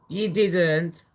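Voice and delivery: normal talking voice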